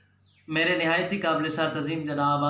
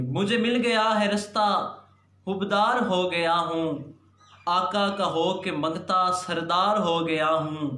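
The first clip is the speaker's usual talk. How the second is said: declaimed naat, half-lines set apart by pauses; poem recitation; 4 semitones higher, slower than usual. Acted. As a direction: recite the verse to a gathering, stretching line endings. Recites Urdu naat to gathering. (महफ़िल में शायराना अंदाज़ में नात पढ़ना)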